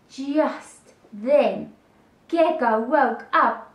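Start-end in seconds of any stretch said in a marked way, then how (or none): none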